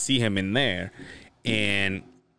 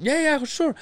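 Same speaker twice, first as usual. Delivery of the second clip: obliging fighter. Retold or imitated. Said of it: "looked at me and goes".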